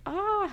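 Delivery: high, falling sigh of overwhelm; overcome, high-pitched exhale. Real, not acted. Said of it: "it's a little overwhelming".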